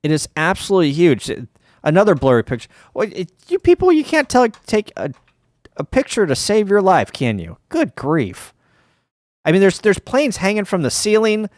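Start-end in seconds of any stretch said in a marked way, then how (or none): 2.9-8.5 pitched up high, teasing scold; mock exasperation. Streamer ribbing the blurry-photo takers, mock exasperated and high. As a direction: needle the bad photographers with exaggerated disbelief.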